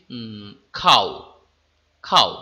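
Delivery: খাও with the final ও only half sounded; half-spoken o. Shown as a demonstration. Teacher demonstrating a half-vowel in খাও. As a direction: say খাও letting the ও fade half-formed. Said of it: "এই খাও ধ্বনিটি যখন উচ্চারণ করছি".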